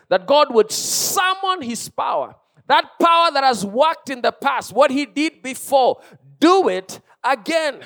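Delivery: high, loud, fervent sermon delivery; impassioned preacher in full cry; full-force preaching. Real, not acted.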